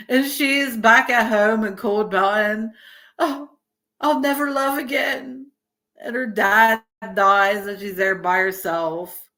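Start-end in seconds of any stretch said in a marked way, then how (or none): 3.2-5.5 high, melodramatic mock lament; lovelorn heroine. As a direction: play a heartbroken film heroine, voice lifted. Voices lovelorn movie heroine.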